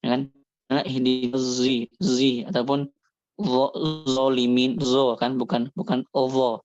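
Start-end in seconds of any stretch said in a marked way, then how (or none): 0.8-2.4 ihdi zi said slowly, sad mixed with zai; slow sad-zai demo. Shown as a demonstration. Teacher slowly showing how ihdi zi is read. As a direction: stretch out ihdi zi with the blended sound. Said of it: "bacaan dha-nya orang-orang awam".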